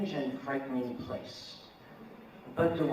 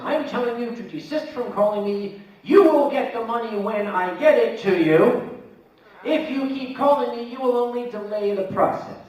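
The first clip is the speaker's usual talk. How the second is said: louder, higher mock anger; mock scathing; 7 semitones higher, 10 dB louder than usual. Debtor's scathing email read as a loud stern warning. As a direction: read the threat like a furious pompous man. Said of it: "very scathing, angry".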